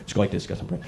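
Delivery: low, soft mumble; busybody fading off. Retold or imitated. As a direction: trail off as he wanders.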